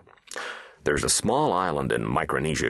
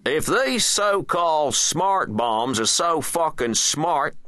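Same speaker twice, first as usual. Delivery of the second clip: high, sharp-edged presidential demand; icy president; 13 semitones higher, 4 dB louder, slower than usual. A narrator's reading. President grilling his defence secretary, icy and high-pitched. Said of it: "asked the president icily".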